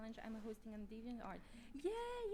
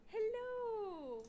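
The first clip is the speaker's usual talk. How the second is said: normal talking voice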